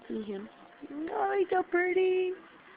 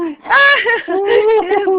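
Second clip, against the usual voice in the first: shrieking laugh; loud laughter; 4 semitones higher, 10 dB louder than usual. Real, not acted. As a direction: burst out laughing. Big laugh at the baby.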